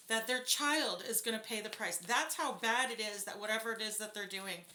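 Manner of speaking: loud, emphatic outrage; infuriated. Real, not acted. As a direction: vent real indignation, hitting key words hard. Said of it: "it just infuriates me".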